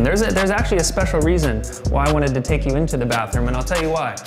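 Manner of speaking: ordinary talk, nothing marked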